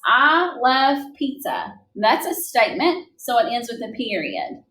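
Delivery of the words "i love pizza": read slowly as an example. Shown as a demonstration. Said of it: "The first sentence I wrote is".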